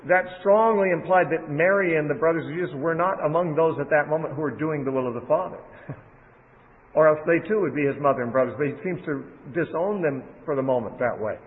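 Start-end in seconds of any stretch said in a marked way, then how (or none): none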